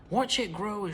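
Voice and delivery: excited shout. A narrator's reading. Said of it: "shouted Mister Cranky".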